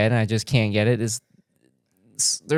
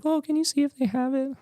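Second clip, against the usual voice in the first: high-pitched pleading request; mock high voice of someone asking a favor; imitating Ashley; 13 semitones higher, 5 dB quieter than usual. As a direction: put on a much higher voice for her asking. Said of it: "she's like".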